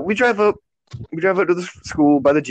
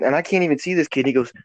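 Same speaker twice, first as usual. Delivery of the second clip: usual speaking voice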